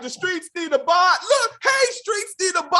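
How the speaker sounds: high, loud, frantic cry; mock excitement. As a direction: call out as if hyped by your own song. Acts out Famous excited by his song.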